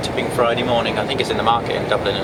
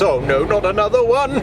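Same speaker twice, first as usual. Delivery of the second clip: higher, whiny dread; scared voice; 10 semitones higher than usual. Acted. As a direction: moan at the next tunnel. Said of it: "It's my scared voice".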